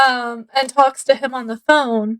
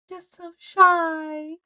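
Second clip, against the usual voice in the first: higher, slow, drawn-out coy voice; mock shy; 4 semitones higher, 3 dB quieter, much slower than usual. Girl putting on shyness to Kiki, mock shy. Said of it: "trying to act shy and aloof".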